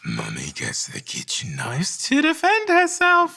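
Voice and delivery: slow high falsetto line; siren falsetto. Shown as a demonstration. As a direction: float the whole line up high, slowly. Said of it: "siren technique".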